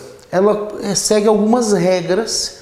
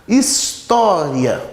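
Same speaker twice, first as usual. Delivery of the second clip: "História" muito devagar, alongando o "tó"; ênfase no TÓ de História; much slower than usual. Shown as a demonstration. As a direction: estique a sílaba tônica de "História". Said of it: "O to é uma sílaba tônica". ("História" arrastado, com o "tó" bem marcado)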